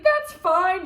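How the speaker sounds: high, loud Southern drawl; Southern guy. Retold or imitated. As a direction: play the easygoing Southern buyer. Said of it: "Like, this Southern guy".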